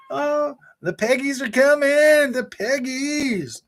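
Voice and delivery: hammed-up dread; mock ominous warning. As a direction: stretch it out like a ghost story. Mock warning about PlayStation fans.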